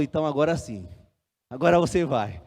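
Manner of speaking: low, soft retold blessing; pastor relenting. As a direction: quietly grant permission as the pastor. Pastor enfim dá a permissão. (pastor consentindo, baixo e sereno)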